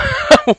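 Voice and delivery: a quick high laugh of delight; bright delighted laugh. Real, not acted. Psychic laughing at the client's timing, delighted laugh. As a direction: laugh up high, pleased with her answer.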